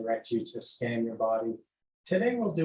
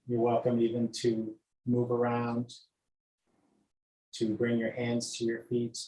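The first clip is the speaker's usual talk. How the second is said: guided meditation. Acted. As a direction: lull them gently from toes upward. Leads body scan meditation.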